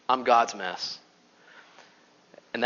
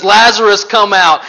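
loud commanding call; raised, authoritative summons; loud cry; 4 semitones higher, 9 dB louder than usual. A narrator's reading. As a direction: call the dead man out forcefully. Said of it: "he cried out in a loud voice".

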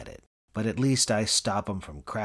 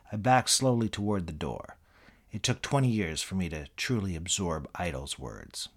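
usual speaking voice

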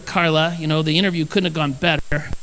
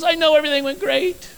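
high mock tearful wife voice; a hammed-up weepy wife; imitating crying wife; 10 semitones higher than usual. Pastor imitating his wife crying over the carpet, mock tearful. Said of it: "She goes".

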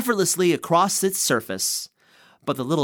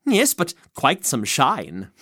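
ordinary delivery

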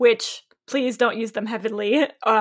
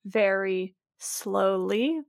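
slow, softer, drawn-out words; 3 semitones lower, 7 dB quieter, much slower than usual. Shown as a demonstration. Author describes her slow-talking character, speaking very slowly. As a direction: stretch each word out, quietly. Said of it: "I have a character who speaks".

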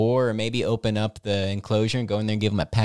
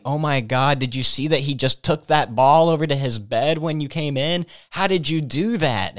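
higher-pitched imitation of an excited staffer; amazed staffer; 5 semitones higher, 4 dB louder than usual. Staff member coming up to the trainers about the dog, amazed. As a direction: gush in mock astonishment, voice lifted.

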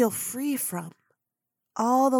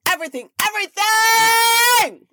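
"everything" yelled much higher and louder at the climax; full shout; 10 semitones higher, 6 dB louder than usual. Real, not acted. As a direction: blast "everything, everything" at the top of your lungs. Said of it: "is what we shout".

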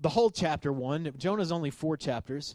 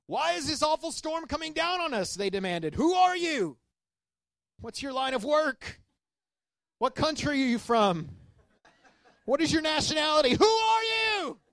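high, urgent sailor voices demanding answers; panicked sailors; 12 semitones higher, 3 dB louder than usual. Acted. Sailors confronting Jonah in the storm, high panicked questioning. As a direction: voice the frightened crew grilling Jonah.

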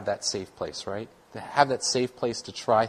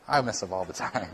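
laughing; 5 semitones lower, 8 dB quieter than usual. Real, not acted. Confessing own failings with a laugh.

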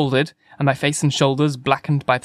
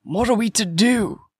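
higher-pitched helpless plea; distraught; 8 semitones higher than usual. A narrator's reading. Curate asks narrator what to do, distraught. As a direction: ask it as a lost, plaintive curate.

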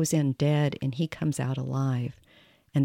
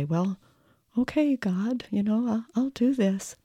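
small, timid voice pitched much higher; little high voice; 7 semitones higher than usual. Retold or imitated. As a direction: shrink into a tiny, meek high voice as you answer God. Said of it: "my little high voice like that".

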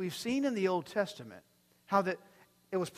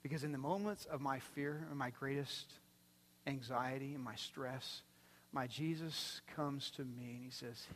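ordinary talk

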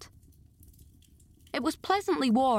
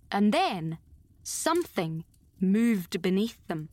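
ordinary delivery

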